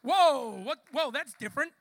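loud, astonished outburst; startled nurse. Retold or imitated. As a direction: imitate a nurse bursting in amazed. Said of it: "The nurse comes running in going".

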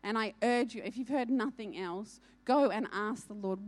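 usual speaking voice

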